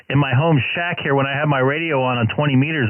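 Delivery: normal talking voice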